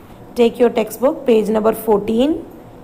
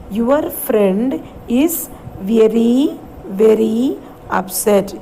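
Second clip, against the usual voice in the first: slow, word by word; slow read-along; slower than usual. Shown as a demonstration. Teacher models the sentence for grade five, slowly. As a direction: drag each word out for the children. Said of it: "So let's read the sentence".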